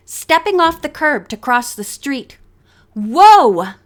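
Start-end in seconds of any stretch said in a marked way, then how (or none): 2.9-3.7 loud, firm stop call; firm whoa. A narrator's reading. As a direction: stop your friend stepping into traffic. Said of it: "Nancy said firmly".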